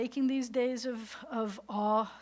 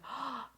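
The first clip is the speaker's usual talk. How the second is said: imitated gasp; 5 semitones higher, 6 dB quieter than usual. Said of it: "and you're like".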